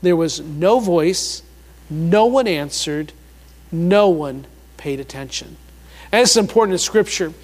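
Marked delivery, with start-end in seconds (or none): 0.0-5.6 "no voice, no one answered, no one paid attention" slow, with pauses between; underlined emphasis. Real, not acted. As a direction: weigh each "no" phrase separately, pitch sinking. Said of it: "M-, underline this".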